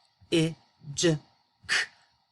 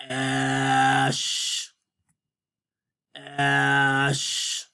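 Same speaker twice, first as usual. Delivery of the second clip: puppet sounding out. Pretend. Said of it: "You do the sounds".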